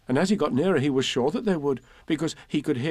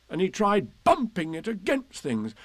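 ordinary delivery

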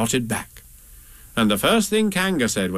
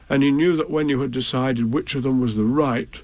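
usual speaking voice

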